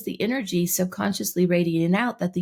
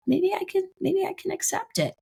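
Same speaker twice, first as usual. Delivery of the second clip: acted dawning hope, pitched way up; high, tentative voice; 13 semitones higher than usual. Coach voicing a subconscious beginning to accept, high tentative voice. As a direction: try the idea out in a small high voice.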